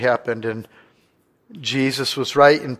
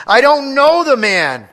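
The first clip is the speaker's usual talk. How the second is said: loud, high, slow shouted denial; jazzed-up shout; 11 semitones higher, 7 dB louder, slower than usual. Retold or imitated. Peter swearing at the accusers, high loud shout. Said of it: "you can hear 'em all jazzed up on this one".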